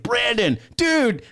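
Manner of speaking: high, excited calling out his roommate's name; rising to a high excited yelp on dude. Retold or imitated. Waking up and calling roommate Brandon, high and excited. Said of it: "I was like".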